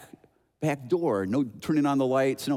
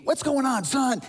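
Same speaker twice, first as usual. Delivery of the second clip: lightly voiced dad, pitched up in urgency; alarmed father; 9 semitones higher than usual. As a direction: call out like a dad rushing in. Dad rushing in, acted.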